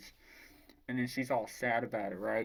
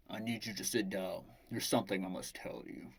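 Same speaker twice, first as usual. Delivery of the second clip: lowered voice of a grave commander; 4 semitones lower, 5 dB quieter than usual. Retold or imitated. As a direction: break it gently, pitched a little down. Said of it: "And Commander's like".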